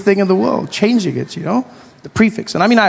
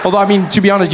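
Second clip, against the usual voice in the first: ordinary talk, nothing marked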